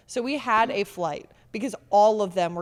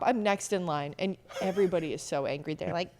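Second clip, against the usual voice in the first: ordinary talk, nothing marked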